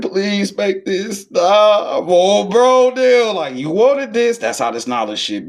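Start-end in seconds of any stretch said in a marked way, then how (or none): 0.0-2.0 high, slow pleading whine; a whiny plea, much higher; mock pleading. Pleading quote in a story. 2.1-4.4 high, loud incredulous outcry; a shocked yelp up high; loud high disbelief; mock outcry. Mocking exclaim in retold scene.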